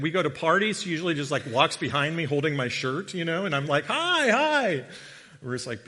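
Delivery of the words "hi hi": high, loud party greeting; exuberant calling out. Retold or imitated. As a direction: hail everyone in a pitched-up voice. Imitating himself greeting people at parties.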